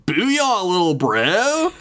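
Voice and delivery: high, drawn-out dude cheer; sudden booyah. A narrator's reading. Ghost surprising Dev over the barbell, sudden high whoop. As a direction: pop up with a high, stretched cheer. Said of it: "with a sudden".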